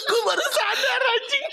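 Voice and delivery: high squealing laugh through words; words squeezed out mid laughter; laughing hard. Real, not acted. Hysterical laughing at a joke.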